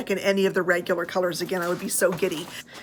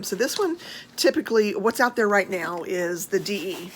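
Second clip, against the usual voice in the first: ordinary delivery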